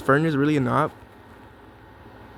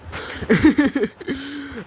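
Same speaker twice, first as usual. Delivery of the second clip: high laugh; 11 semitones higher than usual. Real, not acted. Laughs, losing his mind.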